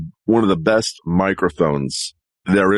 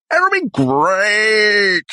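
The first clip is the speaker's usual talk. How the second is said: higher, stretched-out "great"; high drawn-out delivery; 11 semitones higher, much slower than usual. Shown as a demonstration. Performer tries a line over the top, high and drawn out. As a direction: draw it out high and slow. Same words tried two ways.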